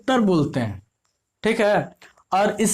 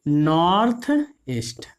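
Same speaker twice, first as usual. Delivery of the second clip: North east said slowly and lower, each word spaced out; slow, lower teacher repeat of north east; 5 semitones lower, slower than usual. Shown as a demonstration. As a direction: spell out north east slowly for the class to write down. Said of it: "ठीक है".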